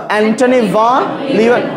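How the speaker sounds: Antony ভন Leeuwen said slowly, syllable by syllable; slow pronunciation drill. Shown as a demonstration. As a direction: drill the name slowly for students. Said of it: "সময় উচ্চারণ করো". (ধীরে ধীরে নামটা উচ্চারণ)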